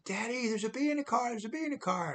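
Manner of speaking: put-on high childlike voice of a scared little girl. Little daughter warning dad from back seat, high child voice. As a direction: pitch way up like a six-year-old calling her daddy.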